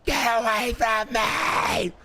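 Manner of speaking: hammed-up high yell imitating the arrestee; mock shriek of the lady. Mocking the arrested lady again.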